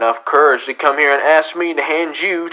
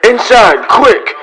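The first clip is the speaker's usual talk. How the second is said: loud, higher, hurried command; urgent order; 7 semitones higher, 5 dB louder, slower than usual. Acted. Marshal hurrying Ziegler away from the angry crowd, urgent shout. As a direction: snap him indoors fast.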